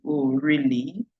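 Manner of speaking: slow, drawn-out sarcastic "Oh, really?" with the stress on "really"; sarcastic disbelief. Shown as a demonstration. Teacher showing caregivers how sarcasm sounds, slow and doubtful. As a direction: stretch "really" out slowly in disbelief. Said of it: "disbelief or sarcasm".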